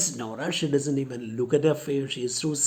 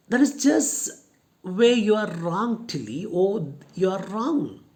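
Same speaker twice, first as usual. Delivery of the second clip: defiant; 5 semitones higher, 4 dB louder than usual. Acted. Nora defiantly contradicts Matilda.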